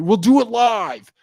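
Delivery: slow, high furious bellow; hammed-up Bill O'Reilly losing it; mock rage shout. Comic host imitating Bill O'Reilly's meltdown, raging shout. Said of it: "Bill O'Reilly one is really famous".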